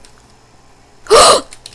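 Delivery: sudden high intake of breath; sharp gasp. Real, not acted. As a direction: gasp in alarm. Sudden alarm at missing stuff.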